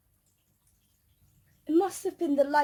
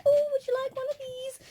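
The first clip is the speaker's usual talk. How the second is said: imitating the Ugly Sisters; 10 semitones higher than usual. Actors voiced offering audience treats.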